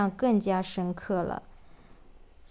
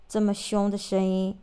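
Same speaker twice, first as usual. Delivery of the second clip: ordinary delivery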